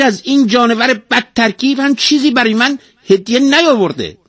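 very high, loud parrot voice; high-pitched, indignant tale-telling bird; talking parrot, aggrieved. A narrator's reading. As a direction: squawk out your complaints as a tattling parrot. Narrator plays the talking parrot.

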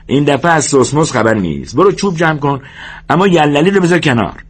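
high-pitched brisk-minded orders; George, ordering. Acted. George sends Lennie for wood before supper, bossy.

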